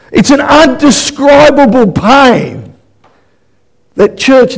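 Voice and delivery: high, slow anguished declaration; impassioned preaching. Real, not acted. Preacher cries out about pain.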